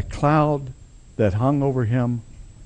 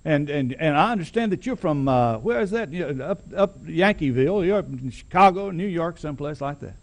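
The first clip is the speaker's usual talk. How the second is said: ingratiating boss man; 6 semitones higher than usual. Retold or imitated. Preacher voicing the big boss sweet-talking the newcomer. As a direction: sweet-talk him like a small-town boss who owns the place. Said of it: "just as ingratiating as he can be".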